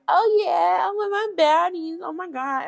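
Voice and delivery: high mocking valley girl voice. Recapping the show, mimicking the other girls in a high voice.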